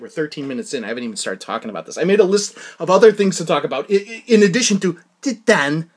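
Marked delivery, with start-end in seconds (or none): none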